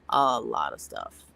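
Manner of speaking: a low, slow echo of the phrase; deep echo. Pretend. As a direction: drop the voice way down, slowly.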